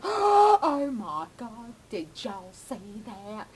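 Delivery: over-dramatic mock shock; Chip Coffey. Psychic to onlookers after seeing it move, over-dramatic. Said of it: "Like, over dramatically".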